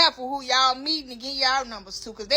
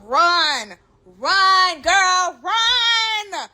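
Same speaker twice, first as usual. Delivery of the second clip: urgent warning; 4 semitones higher, 5 dB louder, much slower than usual. Real, not acted. To viewers, urgent warning to run. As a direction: run run, rising and louder. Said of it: "start running immediately".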